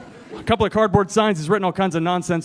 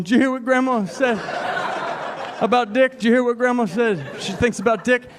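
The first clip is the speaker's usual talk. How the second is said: little brother quote; 4 semitones higher than usual. Retold or imitated.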